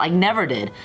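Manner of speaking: usual speaking voice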